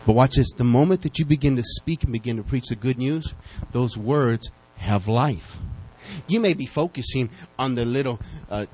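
ordinary talk

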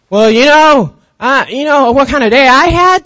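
imitating a mad friend grabbing the conversation; mad complaining friend. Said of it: "They get all mad".

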